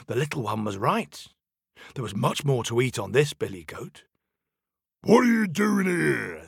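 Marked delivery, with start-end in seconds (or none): 5.0-6.4 louder troll demand. Acted. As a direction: bark it like a troll. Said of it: "said the troll".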